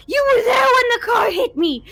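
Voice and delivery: high, loud sobbing cry; a ghost crying out in grief; crying accusation. Acted. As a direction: wail the betrayal.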